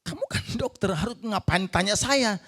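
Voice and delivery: high, disbelieving, with a laugh; half-laughing silent complaint; exasperated inner thought. Retold or imitated. Husband silently mocking the doctor's question, incredulous. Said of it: "saya bilang, dalam hati".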